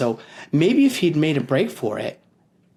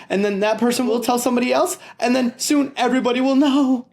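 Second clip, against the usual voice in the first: higher and faster as it builds; rising panic; 10 semitones higher, 3 dB louder, faster than usual. Acted. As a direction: spiral into panic. Peter's panicked thoughts.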